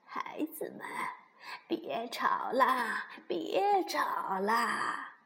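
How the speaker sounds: put-on slow, drawn-out old granny voice; weary granny pleading with quarrelling children; 熊婆婆，劝架. (婆婆拖着长音劝架)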